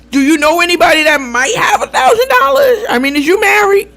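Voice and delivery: loud, high imitation of a borrower; pushy prying. Borrower fishing for someone else's money, loudly pushy. Acted borrower pushing further.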